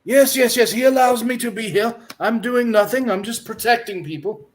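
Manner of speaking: high, pious priest voice; a fussy holy man, busy but polite. Acted. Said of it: "he stops and goes".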